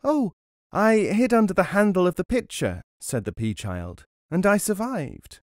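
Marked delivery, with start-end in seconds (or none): 0.0-2.8 put-on high little-boy voice; pea child. A narrator's reading. Pea child explaining escape to father, childlike. 4.3-5.4 put-on high little-boy voice; pea child. A narrator's reading. Pea child telling father he survived, childlike.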